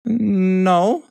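high, drawn-out no; reluctant admission. A narrator's reading. Hen admitting to Mister Horse, high reluctant no. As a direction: admit it up high, drawing out the word. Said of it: "the little red hen admitted".

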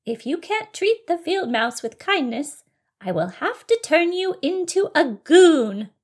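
high-pitched fairy warning. Acted. Fairy warning bunny about becoming a goon, high fairy voice. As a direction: threaten as a fairy, high and emphatic. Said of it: "she said in a fairy-like voice".

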